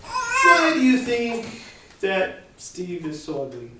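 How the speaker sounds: slow, drawn-out teasing why do you think; rhetorical mock question. Shown as a demonstration. Teacher showing a rhetorical joke question to students. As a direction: tease it out slowly as a joke. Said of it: "It was a rhetorical question".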